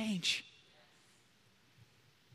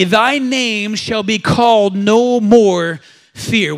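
slow, louder declaration; proclaimed out loud; 7 dB louder, much slower than usual. Real, not acted. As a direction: proclaim it slowly, full voice. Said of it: "we're gonna say it out loud".

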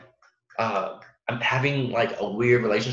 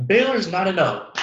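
incredulous; 8 semitones higher, 4 dB louder than usual. Real, not acted. Disbelief at Baylor's record.

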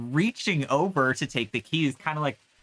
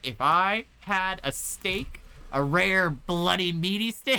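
put-on longing drunk; 8 semitones higher than usual. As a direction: savour each word like a craving. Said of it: "He's like".

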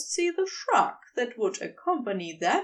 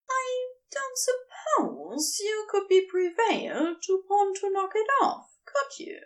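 higher, light and friendly Aziraphale, teasing fondly; light, friendly angel; 8 semitones higher than usual. A narrator's reading. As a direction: gently chide an old friend in a raised voice. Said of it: "keeps his tone light, friendly".

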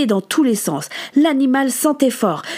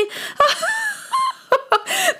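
shrieking burst of laughter; 3 dB quieter than usual. A narrator's reading. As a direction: throw back your head and cackle. Elisabeth roaring with laughter.

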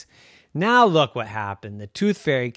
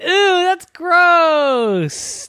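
high, slow, drawn-out grossed-out ooh; child grossed out by fake teeth; disgusted ooh; 6 dB louder, much slower than usual. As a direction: stretch the ooh in kid disgust. Kid disgusted in story.

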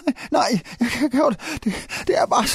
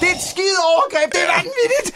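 high, hammed-up indignation; outraged outburst; 4 semitones higher than usual. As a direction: cry out as if gravely violated. Acted outrage at the violation. (skinger, oprevet forargelse)

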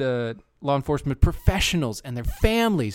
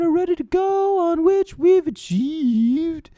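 high, slow, shrieking lady voice; mock Collins voice; 7 dB louder, slower than usual. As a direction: screech it slowly like a fussy old lady.

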